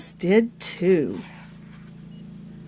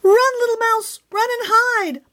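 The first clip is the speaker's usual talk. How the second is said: squawking shout; 11 semitones higher than usual. A narrator's reading. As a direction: shriek at the mouse to flee. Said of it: "squawked and cackled at the top of her lungs".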